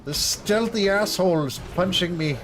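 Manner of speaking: high, hammed-up yelps for help; cartoonish panicked victim voice; mock cry for help. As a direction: squeal like you're being beaten up. Mock cries of a beaten character.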